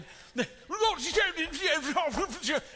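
gibberish in a hammed-up high British voice; high mock British babble with laughter. Real, not acted. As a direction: laugh through a fake British rant. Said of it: "I can't do a very good British accent".

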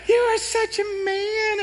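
high, slow mock-admiring wife. Jenny admiring her husband, high slow mock coo. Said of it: "she's like".

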